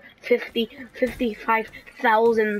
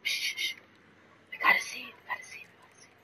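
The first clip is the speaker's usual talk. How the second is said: whisper; 8 dB quieter, much faster than usual. Real, not acted. Sneaking up to see shark.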